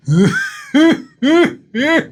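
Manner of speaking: high, drawn-out creepy laugh; Renfield's creepy laugh. Retold or imitated. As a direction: imitate an eerie laugh in separate high bursts. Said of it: "this creepy-ass laugh from Renfield".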